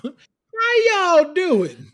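very slow, loud, high Southern greeting; y'all stretched long, Southern; exaggerated Southern drawl. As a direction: ham up the Southern twang. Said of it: "just oozed naturally out of you".

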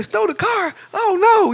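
very high-pitched mock alarm; upset disbelief. Husband hearing the car is stolen, mock upset. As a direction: squeal it in exaggerated dismay. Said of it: "So I'm all upset".

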